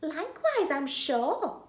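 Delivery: high, genteel polite reply; prim Betty. Acted. Betty answers Bill's greeting, genteel. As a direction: put on airs. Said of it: "Betty says".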